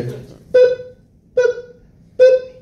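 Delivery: high, evenly spaced boops; imitated beeps. Shown as a demonstration. Imitating the studio beeps before recording, high even boops. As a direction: mimic three cue tones, very high and short. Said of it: "And I hear".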